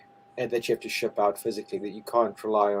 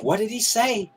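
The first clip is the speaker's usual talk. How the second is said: high-pitched baffled question; exaggerated puzzled query; mock bafflement; 10 semitones higher than usual. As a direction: imitate someone totally lost by the accent. Imitates baffled listener of Glaswegian.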